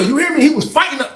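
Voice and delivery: ordinary talk, nothing marked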